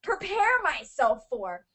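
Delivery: high-pitched exasperated outburst; getting angry. Real, not acted. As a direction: demand answers, worked up and incredulous. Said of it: "I'm getting so angry".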